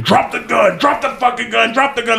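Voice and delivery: retelling cops ordering the suspect, mock yelled high; imitating cops yelling. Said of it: "all I hear is fucking".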